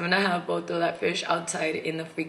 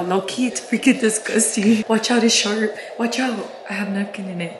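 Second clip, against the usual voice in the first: ordinary talk, nothing marked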